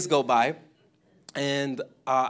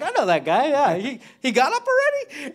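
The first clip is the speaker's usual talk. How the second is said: higher and louder, amazed he is already up; surprised disbelief; 10 semitones higher, 8 dB louder than usual. Retold or imitated. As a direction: blurt it out in happy disbelief. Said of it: "I was like".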